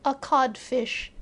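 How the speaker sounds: slow, blank echo. A narrator's reading. Hook to the voice, stunned blank echo. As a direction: repeat it numbly. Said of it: "Hook echoed blankly".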